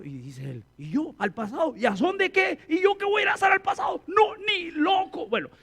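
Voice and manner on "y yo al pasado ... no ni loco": put-on indignant boy. Boy in a viral video asked about returning to the past, indignant shout. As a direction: scoff like an outraged kid. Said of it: "video que veía en redes donde a un muchachito".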